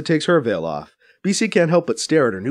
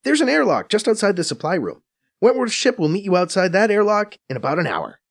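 higher, brisk Faiza voice, a bit scornful; Faiza explaining; 7 semitones higher than usual. A narrator's reading. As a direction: narrator playing Faiza, quick and knowing. Faiza instructing.

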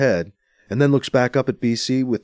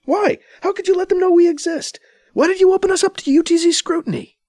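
high, loud accusing questions; angry; 5 dB louder than usual. A narrator's reading. Anita confronting B C, angry. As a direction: demand answers, furious. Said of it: "B C is surprised by her anger".

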